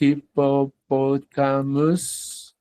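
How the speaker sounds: "hippopotamus" slowly, syllable by syllable; slow, spaced-out "hippopotamus"; long name sounded out. Shown as a demonstration. Teacher teaches the animal's long name, sounded out slowly. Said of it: "el nombre largo es".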